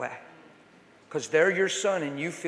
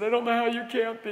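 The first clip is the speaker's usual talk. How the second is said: son voice; 8 semitones higher, 4 dB louder, faster than usual. Retold or imitated. Son overwhelmed by father's love.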